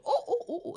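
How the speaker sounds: high, short monkey oohs; monkey hoots. Acted. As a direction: answer in monkey calls. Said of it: "And the monkey goes".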